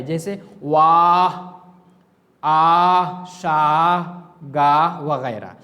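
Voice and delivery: वाह, आह, शाह drawn out loud with a clear final h; clear h sound. Shown as a demonstration. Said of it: "वो हाय मलफूजी कहलाता है".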